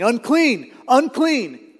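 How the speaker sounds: high, loud call of warning; imitated shout. Leper warning everyone as he walks around, imitated shouting. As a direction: call it out high and drawn, like an outcast. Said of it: "covering his mouth and shouting".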